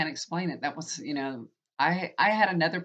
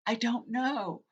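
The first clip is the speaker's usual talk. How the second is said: higher-pitched, mock wailed reply; retold tearful bewilderment; 8 semitones higher, slower than usual. Wife answering husband about her breakdown, retold helpless wail.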